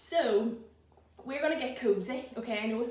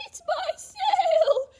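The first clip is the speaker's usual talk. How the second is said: much higher and louder, slow wailing goat; bleating goat; 9 dB louder, much slower than usual. Acted. Goat crying to George about its lost sail, high loud bleat. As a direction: wail it like an upset goat. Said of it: "bleating loudly".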